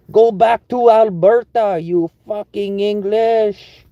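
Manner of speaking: high, slow, hammed-up French-Canadian accent; mock Quebecois rant. Mocking Quebeckers who resent English workers, hammed-up Quebecois accent. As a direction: rant as a caricature Quebecker, drawn out and high-pitched. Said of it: "He doesn't have the Quebecois accent".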